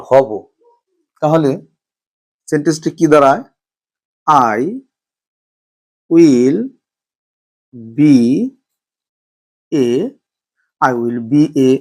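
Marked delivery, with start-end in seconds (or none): 4.5-9.9 slow dictation, each word set apart; long pauses between each word. Shown as a demonstration. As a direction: spell the sentence out one slow word at a time. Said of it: "teacher dictates word by word".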